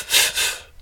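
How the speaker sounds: short breathy f puffs, one per syllable; quiet unvoiced fuffing; pulsed f sounds. Shown as a demonstration. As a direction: puff air through teeth and lip. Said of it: "and invention f".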